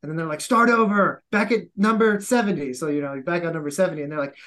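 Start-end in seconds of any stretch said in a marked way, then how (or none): 0.4-2.7 barked drill order, loud and higher; instructor yelling. Retold or imitated. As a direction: yell it like a drill sergeant.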